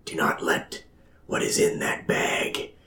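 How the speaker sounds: breathy divine whisper; dramatic whisper. Acted. God speaks into a man's mind, dramatic whisper. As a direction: whisper it like a voice from the gods. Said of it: "a dramatic voice".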